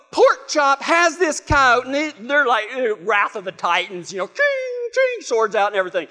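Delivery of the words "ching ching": high ringing ching; sword clang imitation. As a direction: make blades ring out high. Imitates swords being drawn.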